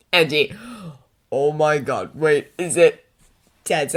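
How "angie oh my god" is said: ordinary delivery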